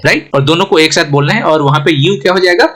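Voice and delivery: ordinary talk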